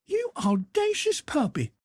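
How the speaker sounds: put-on an aunt snapping at a cheeky boy; shocked rebuke; indignant scolding. A narrator's reading. Aunt Sally scolds after kiss.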